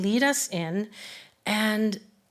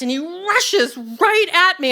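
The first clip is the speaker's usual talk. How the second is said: normal talking voice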